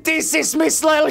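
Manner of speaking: high, loud evil triumph with booming laughs; hammed-up villain cackling at his victim; gloating villain. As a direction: crow over the trap like a cartoon villain. Villain reveals betrayal.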